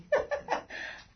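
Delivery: high teary laugh; embarrassed giggle; real laugh. Real, not acted. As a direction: laugh through the tears. Laughing through tears.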